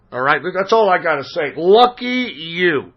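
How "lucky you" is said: slow drawn-out lucky you. Real, not acted. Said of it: "if you don't know who Masked Pony is".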